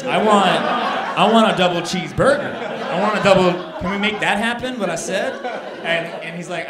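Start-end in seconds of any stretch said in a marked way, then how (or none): 0.0-5.3 loud, insistent acted complaint; indignant demand. Customer arguing with clerk over cheese, indignant demand. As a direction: push each demand out louder, like a fed-up customer.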